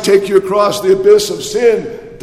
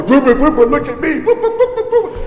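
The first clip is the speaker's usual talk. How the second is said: showboating player; 9 semitones higher, 3 dB louder than usual. Retold or imitated. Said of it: "They didn't go out there and go".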